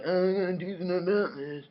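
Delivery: muffled, slurred mock impression; Doctor Moreau. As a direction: mumble it in a throat-straining caricature. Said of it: "voice hurts".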